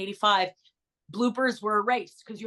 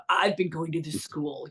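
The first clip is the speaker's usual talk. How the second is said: lightly voiced bored teen, lower; Curtis impression; 5 semitones lower than usual. Actress imitating Curtis at the table read, lower slouchy voice. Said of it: "And Curtis, you know".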